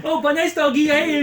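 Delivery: high, loud Tommy Wiseau voice; Tommy Wiseau impression. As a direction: ham up a Tommy Wiseau line. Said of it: "Tommy Wiseau is, like, saying".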